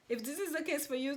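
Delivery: normal talking voice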